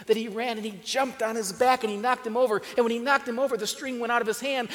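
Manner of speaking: loud, rising excitement in the telling; excited. A narrator's reading. Storyteller telling of the dog knocking Jeffrey over, loud and excited. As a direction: burst out as the dog knocks him down.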